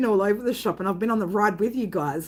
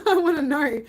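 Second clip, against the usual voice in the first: said through a laugh, higher; 6 semitones higher than usual. Real, not acted. Streamer asking viewers about a band, laughing through it. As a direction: giggle the words out.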